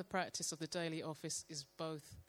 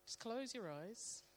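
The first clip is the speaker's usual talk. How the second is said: guided meditation; 4 dB quieter than usual. Acted. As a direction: guide them gently into stillness. Leads a guided breathing prayer.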